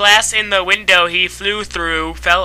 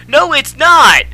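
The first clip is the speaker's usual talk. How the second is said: high, loud protest; angry shout; 7 semitones higher, 5 dB louder than usual. A narrator's reading. Heroine contradicting her friend, shouted angrily. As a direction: snap back loudly in mock anger. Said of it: "I shouted angrily".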